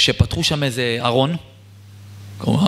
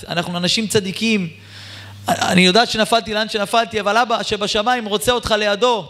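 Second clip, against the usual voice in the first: urgent plea; 7 semitones higher than usual. Acted. Mother urges son, son asks proof.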